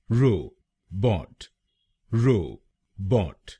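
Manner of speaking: Robot stretched out very slowly, ro and bot drawn apart; slowed down. Shown as a demonstration. Teacher slowing the word for learners, very slow robot. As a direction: drag robot out syllable by syllable.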